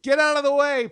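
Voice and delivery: loud, high urgent yell; shouted warning. Retold or imitated. Dolly shouting warning to child.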